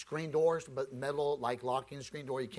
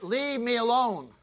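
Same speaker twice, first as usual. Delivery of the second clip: louder and slower, suddenly cold; tone changes; 9 semitones higher, 11 dB louder, slower than usual. Retold or imitated. Woman behind the door warns the preacher, slow and sharp. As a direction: draw it out as a warning, now annoyed. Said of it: "You know when a tone changes".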